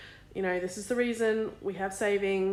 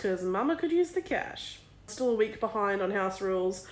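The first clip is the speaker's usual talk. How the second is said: ordinary talk, nothing marked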